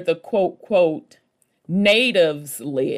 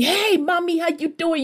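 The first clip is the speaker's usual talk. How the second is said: much higher, smooth New Yorker greeting; slick New York guy; 12 semitones higher than usual. Retold or imitated. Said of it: "how the New Yorkers are with that slick ass talk".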